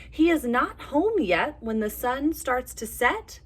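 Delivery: lively storybook read with voiced animals; reading to children. A narrator's reading. Teacher reading a bear story to little kids, animated. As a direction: tell it like a bedtime picture book. Reads picture book aloud.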